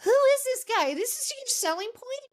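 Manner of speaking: mock shock at the salesman; you've got to be kidding me; incredulous. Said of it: "you're like".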